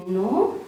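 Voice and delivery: "No" sliding upward, unsure; doubtful rising intonation. Shown as a demonstration. Unsure whether they've seen the dog, doubtful rising "No". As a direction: let "No" climb like a question. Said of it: "you doubt".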